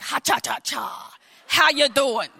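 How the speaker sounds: lightly voiced cartoon bugs, jaunty; a hint of cartoon critters swaggering; cartoon bed bugs. Bed bugs greeting her from under the mattress, jaunty. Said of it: "they were like".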